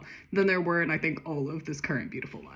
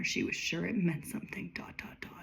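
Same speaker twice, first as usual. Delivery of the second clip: whisper; 8 dB quieter than usual. Acted. Mysterious reading of odd symbol.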